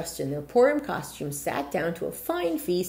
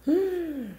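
surprised gasp; 10 semitones higher than usual. Acted. Razel's magic transformation.

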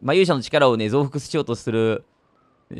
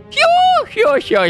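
high shrieking hyo-hyo cackle; villain cackle; 5 dB louder than usual. Acted. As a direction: cackle like a gleeful mad scientist. Mad scientist villain cackles.

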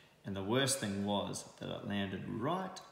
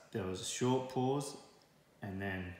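plain reading voice

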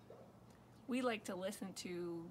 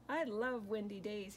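ordinary delivery, nothing marked

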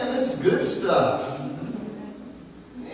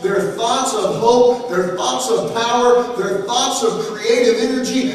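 building pulpit cadence, louder toward the end; preaching at full force; 2 semitones higher, 4 dB louder than usual. Acted. Preacher listing God's good thoughts to the church, building preaching. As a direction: stack each phrase like a preacher's crescendo.

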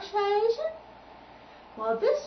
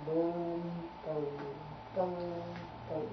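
tuba boom imitation; 5 semitones lower, 7 dB quieter, much slower than usual. Shown as a demonstration. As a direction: lower booming tuba notes. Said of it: "they play low notes like this".

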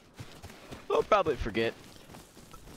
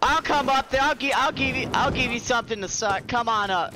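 taunt; 8 semitones higher, 3 dB louder than usual. Pretend. Taunting player.

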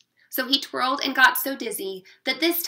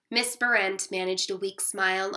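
plain reading voice

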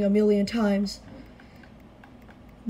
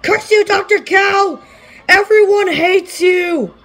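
loud slow cursing yell; angry shout; 7 semitones higher, 6 dB louder, slower than usual. Real, not acted. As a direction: curse the boss at full voice. Yelling at boss.

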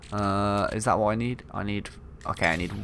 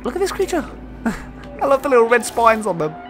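delighted; 10 semitones higher, 3 dB louder, faster than usual. Real, not acted. Delight at creature.

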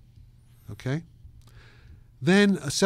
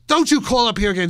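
mother's angry shout; 8 semitones higher, 8 dB louder than usual. Retold or imitated.

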